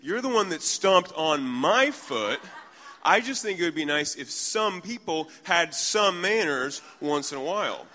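hammed-up outrage, high and loud; indignant retort. Third woman retorting to woman who stomped her foot, mock-indignant and loud. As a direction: fire back loudly, punching the accusing words.

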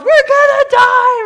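high, drawn-out, full-volume scream of terror; panicked screaming. Acted. Disciples caught in a rising storm, screaming in panic. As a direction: scream it slowly at the top of your voice. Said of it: "then they start screaming".

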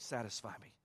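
normal talking voice

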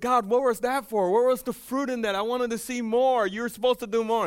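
high and loud, frustrated protest; indignant complaint to God. Retold or imitated. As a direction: retell demanding answers from God.

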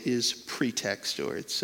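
ordinary talk, nothing marked

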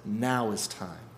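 low, slow, soft assurance; slow and settled, no hurry; kindly God. Acted. God answers our doubts, low and calm. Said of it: "but God says".

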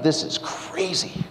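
slow breathy whisper in disbelief; stunned whisper. Retold or imitated. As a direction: whisper it to yourself, dragged out. Stunned aside at church.

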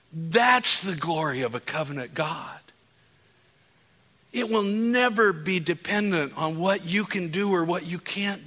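high, loud, slowed fervent preaching; impassioned altar-call preaching; full-force preaching. Acted. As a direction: build it up and pour it on the crowd.

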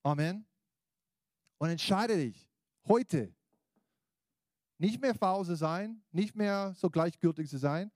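loud, high preacher's call; rousing sermon voice; preaching. Acted. Preaching appeal.

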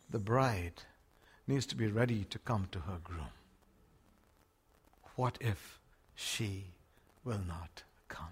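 low, soft whisper; hushed whisper. Real, not acted. Preacher warning the church in a low whisper. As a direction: draw them in with a hush.

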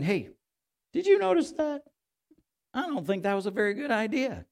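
a prying remark to God; mock busybody. Imitating us correcting God.

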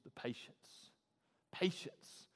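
breathy whisper, trailing off. Acted. Pastor to congregation, whispered. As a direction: say it under your breath.

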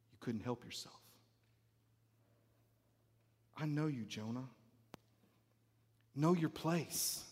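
very low, hushed, with long pauses; quietly knowing God. Acted. God quietly answering Jonah's accusation, hushed and knowing. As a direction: answer softly with calm authority. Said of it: "And yet God is like".

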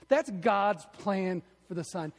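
usual speaking voice

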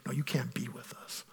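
soft whisper; whispered rejection. Pretend. Church leaders shutting someone out, whispered. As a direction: breathe the rejection out.

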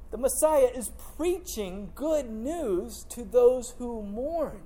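slow, deliberate preaching that rises a little; building sermon. Acted. Pastor telling the church Jesus fulfils prophecy, slow and rising. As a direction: preach it out phrase by phrase.

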